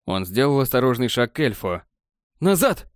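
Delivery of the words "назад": high, sharp warning cry; acted shout. A narrator's reading. Armed elf to Borland stepping closer, shouting. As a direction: order him back, pitch jumping up. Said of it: "крикнул тот".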